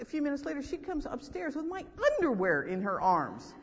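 ordinary talk

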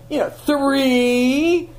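teasing the counting mother, slow and high; mock counting. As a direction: drag out the count to tease her. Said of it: "and I'm going".

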